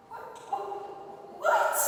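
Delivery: high, climbing what, what, what; baffled by the traffic, voice shooting up; confused disbelief. Retold or imitated. Vlogger recalling oncoming cars, shrill high disbelief. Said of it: "I was like".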